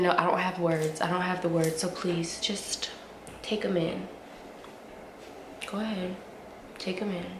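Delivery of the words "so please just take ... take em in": soft whisper. Real, not acted. Urging viewers to admire the glasses, hushed. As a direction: coax them softly to look.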